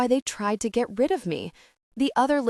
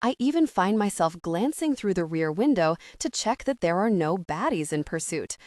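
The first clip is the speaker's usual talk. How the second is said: usual speaking voice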